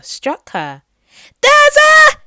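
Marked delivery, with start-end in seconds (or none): 1.4-2.2 very high, very loud alarm yell; shout. Acted. Prankster girl falsely warning the crowded beach: full-voice shout. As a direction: scream it to scare the whole beach. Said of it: "she shouted at the top of her voice".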